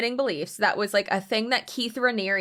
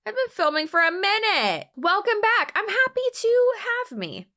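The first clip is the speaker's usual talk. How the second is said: high, silly voice greeting viewers; squeaky mock intro voice; 11 semitones higher than usual. To her viewers, a giddy squeaky mock welcome. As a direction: play tired host gone giddy, pitched way up.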